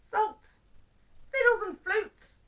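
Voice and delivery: high, loud storybook outrage; exasperated outburst. Acted. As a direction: exclaim in indignant disbelief. Old man finds salt, not oatmeal.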